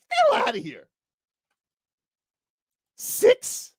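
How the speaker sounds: very high, incredulous squeal; outraged disbelief. Real, not acted. Outraged at the ranking.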